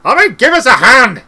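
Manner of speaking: loud, high snapping demand; snapped. A narrator's reading. Cutangle demanding help from the whirlpool, snapped. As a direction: snap it out, shouting for help. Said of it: "snapped Cut Angle".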